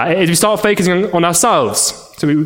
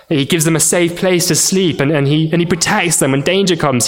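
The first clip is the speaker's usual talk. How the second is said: normal talking voice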